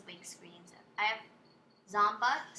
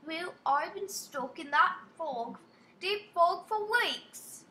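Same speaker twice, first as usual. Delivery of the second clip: slow, high mock-British reading; attempted British accent; 4 semitones higher, 3 dB louder, slower than usual. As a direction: try British, drawing the words out. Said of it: "I'm trying to go British".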